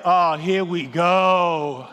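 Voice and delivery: drawn-out groan of dread; dreading resignation. Retold or imitated. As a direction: stretch it out slowly, already fed up. His dread at hearing the rep.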